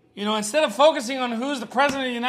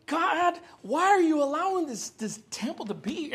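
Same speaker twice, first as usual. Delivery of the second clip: put-on child's outcry; 7 semitones higher, 3 dB quieter than usual. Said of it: "he's just going off".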